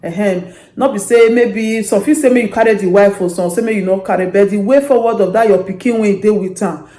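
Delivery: ordinary talk, nothing marked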